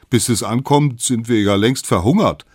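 loud, exasperated whine; hungry complaint. Acted. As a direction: complain loudly to the one in front.

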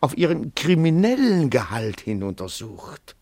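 high and loud, pressing his point; urgent protest. Acted. Detective hurriedly defending himself to the writer, urgent. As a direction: blurt it out before he is thrown out.

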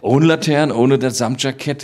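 light up at the memory; excited recall. Real, not acted. Excitedly recalling the famous actor.

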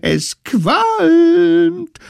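viel höher und lauter Jubelruf; jubelnd. Acted. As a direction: jubelt es wie triumphierende Kinder hinaus. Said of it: "jubelten die kleinen Brandstifter". (hoher, lauter Jubelschrei der kleinen Brandstifter)

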